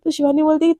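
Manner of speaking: ordinary delivery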